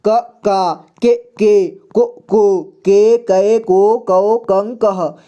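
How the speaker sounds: "क, का" onward chanted apart, short vowel then long; clear syllable recitation. Shown as a demonstration. Teacher reads the barakhadi clearly, "क" to "कह" syllable by syllable. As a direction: recite each syllable from "क" to "कह" distinctly. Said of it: "एक बार स्पष्ट रूप से पढ़ते हैं".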